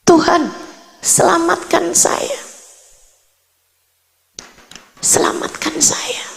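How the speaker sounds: high, thin plea to God, softening almost to a whisper; pleading for mercy with long pauses; retold desperate prayer. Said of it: "Saya kemudian mulai berdoa".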